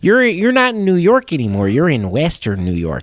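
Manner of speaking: playful Buffalo local voice; correcting the visitor in a Buffalo accent. Retold or imitated. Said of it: "Buffalo's got a little bit of".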